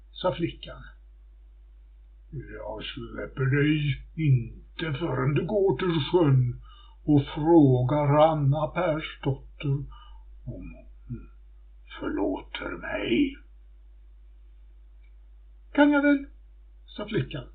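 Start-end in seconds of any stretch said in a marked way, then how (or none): 2.3-13.4 grim corpse. Acted. Corpse speaks to the girl. 15.7-16.2 high, loud, cocky quip; cheeky maid's reply. Acted. The maid, held by her throat, bargains with the corpse in a high, loud, cocky voice. Cheeky girl agrees a third time.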